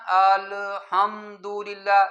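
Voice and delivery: الحمدللہ very slow, with the ال and the حم held unevenly; teacher showing uneven lengths in الحمدللہ, very slow; uneven timing demo. Shown as a demonstration. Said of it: "کسی کو زیادہ کرنا، کسی کو کم کرنا".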